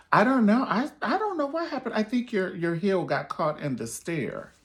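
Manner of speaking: high, loud play-innocent protest; mock innocent denial. Pusher loudly pretending not to know what happened, voice pitched high. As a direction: act wide-eyed and blameless.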